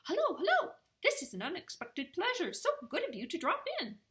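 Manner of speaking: high, loud, gushing welcome; excitable little bat greeting guests; really excited. A narrator's reading. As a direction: squeal hello, then rush on delightedly. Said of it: "he got really excited".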